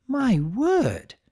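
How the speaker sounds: gasping lady exclamation. Acted. Rarity gasping.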